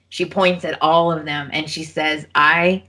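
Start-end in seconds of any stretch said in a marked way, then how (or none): 2.3-2.8 spaced-out, deliberate retold declaration; grave vow. Quoting character's furious parting line.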